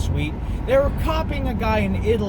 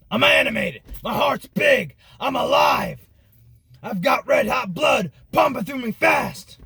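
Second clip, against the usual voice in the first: higher, shouted conspiracy-radio tirade; full-throated hammed-up ranting crescendo; Alex Jones rant; 6 semitones higher than usual. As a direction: roar it at the globalists like a fired-up host. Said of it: "my Alex Jones impression".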